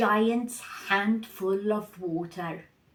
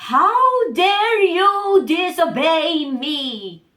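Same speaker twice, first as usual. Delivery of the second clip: high, loud, booming "How dare you disobey me"; raging god shouting at his children; furious father; 7 semitones higher, 10 dB louder than usual. Acted. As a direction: let the rage burst out. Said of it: "he was angry at his children".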